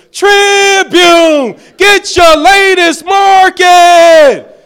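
newsboy yelling back at the manager; yelling paper seller. Retold or imitated. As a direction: shout it at the store manager. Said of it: "I would yell out to him".

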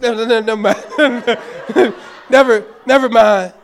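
loud flustered laughing take-back; embarrassed laughing retreat. Retold or imitated. Pastor backpedaling after the steroids joke, laughing. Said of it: "I said".

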